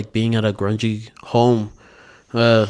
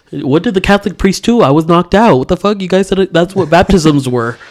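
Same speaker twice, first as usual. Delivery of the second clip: higher, louder, joking indignation; half-laughing protest, voice pitched up; mock outrage; 6 semitones higher, 6 dB louder than usual. Real, not acted. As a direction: play up the shock at being knocked out. Mock outrage about baptism.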